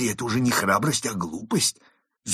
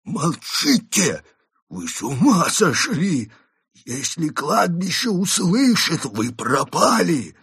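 high, loud, slow panicked shout; panicked wolf; 11 semitones higher, 6 dB louder, slower than usual. A narrator's reading. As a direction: hush them in terror. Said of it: "замахал на Константина лапами".

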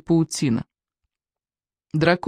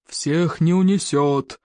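slow yell over the flames; slower than usual. A narrator's reading. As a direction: bellow it over roaring fire. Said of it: "проорал незнакомец".

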